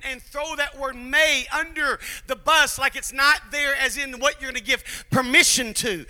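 loud, rising preacher's cry; full-force preaching. Acted. Pastor rousing the congregation about the lion, loud preaching. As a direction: drive the point home from the pulpit.

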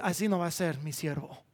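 low, soft, measured statement; God speaking gently. Acted. God describing his servant who needs no display, soft and calm. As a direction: quietly set it apart from the clamor.

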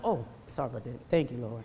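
low, soft and chastened; sheepish apology. Retold or imitated. Preacher retelling her answer to the Lord, small and apologetic. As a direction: back down quietly, meekly grateful. Retold apology after being corrected.

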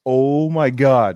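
slow, loud and high; stunned. Retold or imitated. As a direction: drag out the amazement. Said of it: "I saw them again and was like".

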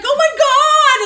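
high, loud, slow exasperated groan; hammed-up mother's oh my God; mock exasperated mom. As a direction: sigh it like a fed-up mom.

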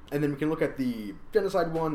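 ordinary talk

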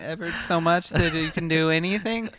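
put-on high excited little kid voice; child voice. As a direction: stumble through it like a small kid. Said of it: "say it in a childlike voice".

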